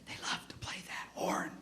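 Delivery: hushed, voiceless emphasis; emphatic whisper. Real, not acted. Speaker reminds teachers what kids love, whispered. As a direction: let it drop to an intense whisper.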